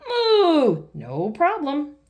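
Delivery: high, loud moo, then a slow friendly reply; a happy bull glad to help. Acted. Mister Bull answering Mummy Pig's thanks with a loud moo. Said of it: "says Mister Bull".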